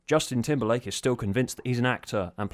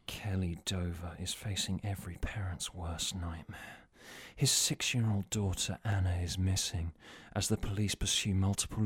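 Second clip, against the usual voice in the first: low, breathy sultry purr; slutty Playboy model voice; 6 semitones lower, 9 dB quieter than usual. Acted. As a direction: purr the press release seductively. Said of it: "in a slutty Playboy model voice".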